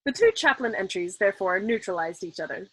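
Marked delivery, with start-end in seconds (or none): none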